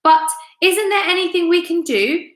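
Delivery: high desperate plea; crying out. A narrator's reading. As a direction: plead in panic. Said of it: "cried Missus Twit".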